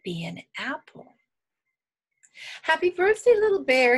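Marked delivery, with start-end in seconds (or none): 2.6-4.0 high, loud birthday greeting; lively storybook Hen greeting; cheerful greeting. A narrator's reading. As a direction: call it out high to the birthday bear.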